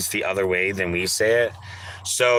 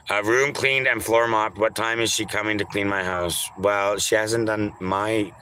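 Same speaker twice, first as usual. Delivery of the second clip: usual speaking voice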